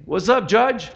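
breezy, overly casual hey to a judge; mock casual greeting. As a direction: toss it off like greeting a buddy. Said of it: "we don't say".

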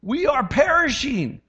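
high, slow panicked cry; mock panicked plea. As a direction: wail like frightened fishermen. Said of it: "And they're like".

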